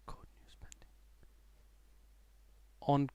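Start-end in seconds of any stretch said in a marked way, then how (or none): 0.1-0.9 barely voiced, whispered read-out; hushed reading. Real, not acted. Trader reading a halt notice to himself, whispering. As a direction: whisper it to yourself as you read.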